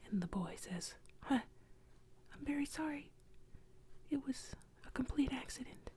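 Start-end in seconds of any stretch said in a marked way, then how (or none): 1.2-3.1 lightly voiced boy, higher whisper; a hint of a nervous boy apologizing; frightened boy. 4.1-5.9 put-on higher, nervous boy whisper; a hint of a scared boy; frightened boy. Boy apologizes to ghost.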